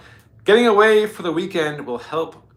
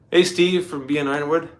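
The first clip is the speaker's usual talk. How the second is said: ordinary talk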